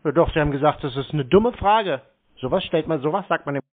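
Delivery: loud, fast, furious scolding; angry interruption. Real, not acted. Caller yells at the agent to shut up, fast and loud. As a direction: cut her off and yell her down.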